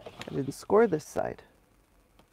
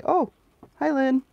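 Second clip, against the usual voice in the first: high, louder cheery hello again; repeated greeting; 8 semitones higher, 5 dB louder than usual. Retold or imitated. Mock cheery hello to Lynn forgetting she said it. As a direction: chirp it up high once more. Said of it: "and I'll say".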